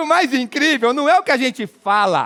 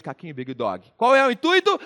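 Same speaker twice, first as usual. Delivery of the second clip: ordinary talk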